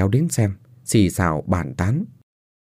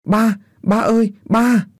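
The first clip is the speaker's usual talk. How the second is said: put-on much higher, childlike wailing; child's crying call; 13 semitones higher, slower than usual. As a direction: wail for daddy like a small girl. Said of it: "Đứa con gái khóc ré lên". (bé gái khóc ré gọi ba)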